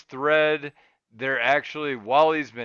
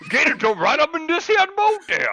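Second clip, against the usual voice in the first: high, loud hammed-up Cajun call; Cajun accent; 12 semitones higher, 5 dB louder than usual. Host calling a gator over in a hammed-up Cajun accent. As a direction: holler to the gator like a bayou man. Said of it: "my Southern jambalaya eater".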